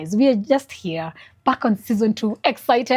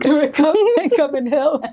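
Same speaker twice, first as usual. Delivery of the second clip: laughing through the words, pitched up; 8 semitones higher than usual. Real, not acted. Host laughing at the husband who woke up late, high and amused.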